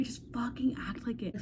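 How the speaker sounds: breathy whispered aside to herself. Real, not acted. Vlogger whispering advice to herself, under her breath. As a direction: hush it like a secret dare.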